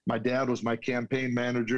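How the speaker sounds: ordinary delivery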